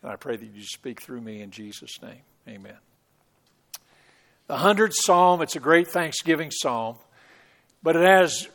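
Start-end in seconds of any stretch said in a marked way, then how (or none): 0.0-2.8 soft, low prayer to open the sermon; quiet prayer. Real, not acted. Pastor praying softly before preaching. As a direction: hush your voice and pray.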